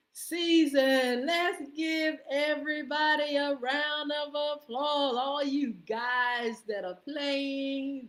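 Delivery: loud, slow, high-pitched call for applause; rally the crowd, big and drawn out; game-day MC pumping up the crowd; hype announcer. Real, not acted. Hyping a round of applause.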